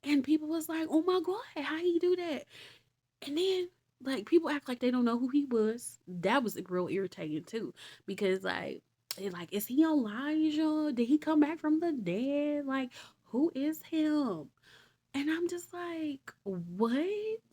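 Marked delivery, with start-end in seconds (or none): 0.9-2.5 high, amazed gasp of a crowd. Retold or imitated. Onlookers reacting to the healed man, high amazed voices. 9.5-14.5 high, slow puzzled questioning; puzzled crowd. Retold or imitated. The crowd asking who Jesus is, slow high puzzled voices.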